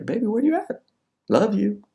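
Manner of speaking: high, put-on girlfriend voice; sweet-talking girlfriend. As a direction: imitate a girlfriend checking in. Acted girlfriend's text message.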